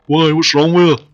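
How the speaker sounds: loud, high offended comeback; hammed-up hard-man indignation; mock indignation. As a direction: take offence like a touchy boyfriend. Acting out boyfriend's indignant comeback.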